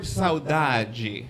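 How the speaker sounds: ordinary talk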